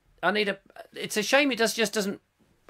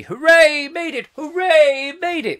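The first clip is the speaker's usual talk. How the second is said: excited cheer; 9 semitones higher, 7 dB louder than usual. Retold or imitated. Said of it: "he says".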